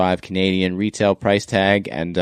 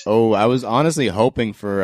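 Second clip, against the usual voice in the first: normal talking voice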